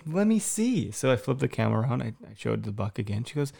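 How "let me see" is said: put-on high eager child voice; little girl asking. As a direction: ask it like an impatient toddler. Daughter asks to see the deer.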